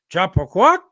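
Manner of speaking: imitating a Sasquatch calling out a question; mock Sasquatch question. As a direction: call it out like a creature asking something. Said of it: "He says it again".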